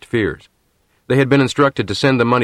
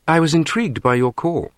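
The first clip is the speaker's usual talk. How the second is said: put-on crisp English accent; 7 semitones higher than usual. A narrator's reading. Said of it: "The same crisp English accent".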